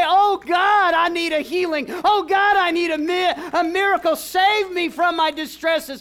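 high, loud, slow plea for healing; shouted prayer. Acted. Preacher modelling a desperate prayer for the congregation, loud plea. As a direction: cry out to God for rescue.

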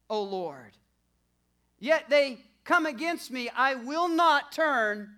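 loud, high, building sermon delivery; preaching at full force. Acted. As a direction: preach it with rising fire.

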